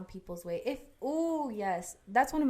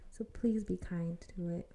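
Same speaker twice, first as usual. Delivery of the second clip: breathy whispered plea; 6 dB quieter than usual. Real, not acted. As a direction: whisper it gently. Soft closing plea.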